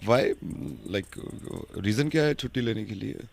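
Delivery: low-voiced HR manager. HR manager phoning an employee about leave, a low formal voice. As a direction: sound like a polite senior officer.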